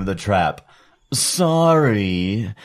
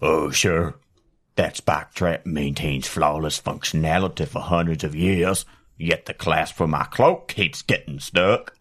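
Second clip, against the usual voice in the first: low, sardonic dungeon lord; deep villain voice; 10 semitones lower, 3 dB quieter than usual. Acted. Improv villain character line.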